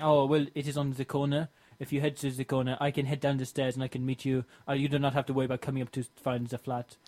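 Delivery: put-on restrained French accent giving directions; toned-down French accent. Philippe directing the driver, careful French accent. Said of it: "I'm still managing the French accent".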